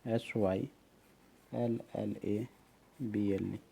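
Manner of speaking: quiet letter-by-letter spelling of सिलेबल; spelled out letters. Shown as a demonstration. Teacher spelling a word softly for students. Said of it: "सिलेबल सुना है".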